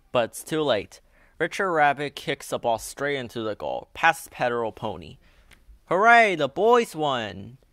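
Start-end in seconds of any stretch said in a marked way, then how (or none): 5.9-7.6 loud, higher cheer; cheering Danny. A narrator's reading. Danny cries out that the boys won, loud cheer. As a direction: celebrate the win. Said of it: "cries Danny".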